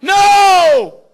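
high, loud cry of no; sudden shout. Acted. Preacher to congregation, sudden loud no. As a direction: shout it out, sharp.

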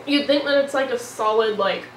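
talking between bites; just swallowing cake; slight mouthful of cake. Real, not acted. Seen 2: Red-hoodie speaker bit cake just before, holds it while talking.

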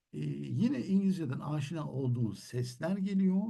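normal talking voice

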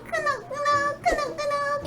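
high squeak for each nod; squeaky high voice. Acted. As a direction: chirp it up high. Squeaky nodding.

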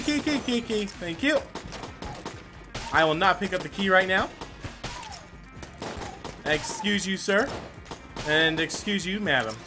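ordinary talk, nothing marked

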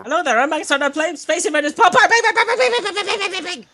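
very high, loud announcer with bang sound effects; hyped youtuber. Pretend. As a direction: shout the bangs like a cartoon gun. Mocking youtuber intro with sound effects.

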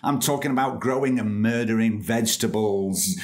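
usual speaking voice

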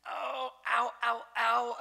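high, loud hammed-up "ow, ow"; mock pain. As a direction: yelp in mock pain at growing up. Joking about painful maturity.

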